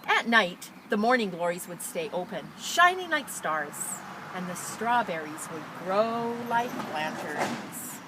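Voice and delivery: expressive picture-book read-aloud; reading to children. Acted. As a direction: read it out brightly to a class. Teacher reads picture book aloud.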